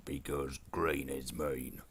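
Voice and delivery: orc boss, slow on the slogans. Pretend. Orc boss preaching green to his henchman, slow orc voice. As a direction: lay down orc wisdom, drawn out. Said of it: "doing this orc voice".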